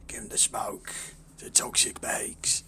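low, whispery gas-mask villain; gruff Batman-like voice. Acted. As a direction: rasp it slowly in a low whisper. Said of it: "with that fucking voice".

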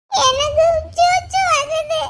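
put-on high, drawn-out little-kid plea; pleading small child. Child asking teacher for the toilet, pleading little voice. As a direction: whine it slowly like a toddler needing the toilet.

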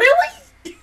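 high, laughing disbelief; can't believe what she's seeing; squeaky stunned really; incredulous really. Retold or imitated. Disbelief watching the show.

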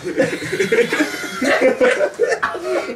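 high, falling laugh that runs out of breath; real giggling fit, high and long. Real, not acted. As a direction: laugh helplessly at the memory. Laughs at own accident.

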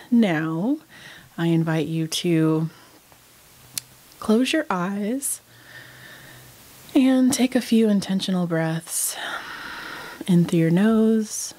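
unhurried meditation guide, long pauses between phrases; guided meditation. Real, not acted. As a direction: lead them calmly through breath and body, leaving space after each phrase.

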